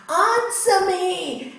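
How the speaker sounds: loud, slow furious demand; angry yell. Acted. Landlord shouting at the calm Swami, furious. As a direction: bellow it at him, dragging it out.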